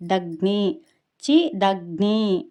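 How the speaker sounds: చి light and short, దగ్ని with గ్ని pressed; light చి, then a firm గ్ని in దగ్ని; stressed conjunct. Shown as a demonstration. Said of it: "ఒత్తి గ్ని అని పలకాలి".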